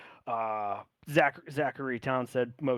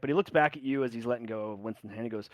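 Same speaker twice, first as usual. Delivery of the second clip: ordinary talk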